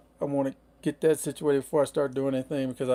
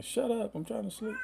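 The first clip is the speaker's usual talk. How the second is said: much higher, fading softer, mock grumpy dog; voicing the grumpy dog; 9 semitones higher, 7 dB quieter than usual. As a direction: speak for the dog woken from a nap. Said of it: "He's yelling at me".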